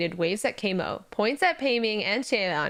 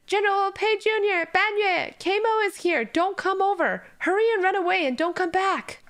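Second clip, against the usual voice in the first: high, desperate shouted warning; frantic shouted alarm; urgent shout; 10 semitones higher than usual. Acted. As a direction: yell it so they hear from far away. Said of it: "Xie Lian shouts again".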